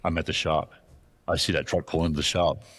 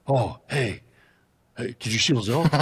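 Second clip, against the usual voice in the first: lightly voiced eager stranger, pitched higher; the other hunter; 5 semitones higher, 3 dB louder than usual. Retold stranger asking the hunter about elk, higher eager voice. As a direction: play the clueless guy, a touch higher and chirpy.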